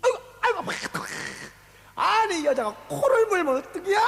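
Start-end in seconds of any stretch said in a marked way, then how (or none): 0.0-1.0 high mock wail of pain, "아이고". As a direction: cry out, nose just got bitten. Husband's nose got bitten. (코 물린 남편의 높은 비명 "아이고")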